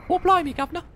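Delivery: very high-pitched cartoon British; mock British accent. Shown as a demonstration. Teaching co-caster a British accent, cartoonishly high. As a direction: squeak out a caricature British accent. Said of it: "you just have to repeat after me".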